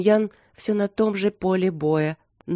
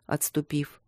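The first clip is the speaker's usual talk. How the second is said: plain reading voice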